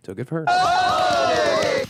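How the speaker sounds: high, slow, shouted cheer; mock cheer. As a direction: belt it like a sarcastic celebration.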